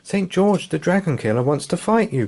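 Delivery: put-on urgent, high child voice; crying out. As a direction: blurt out the warning. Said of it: "cried the boy".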